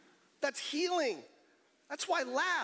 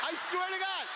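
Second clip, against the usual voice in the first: shouted insistence; 8 semitones higher than usual. Real, not acted. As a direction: cry out the oath. Insisting to crowd.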